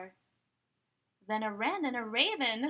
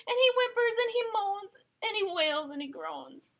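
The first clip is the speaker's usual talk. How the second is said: high, loud, sobbing wail; crying voice; 10 semitones higher, 5 dB louder than usual. A narrator's reading. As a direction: read it as if crying. Bear crying narrated.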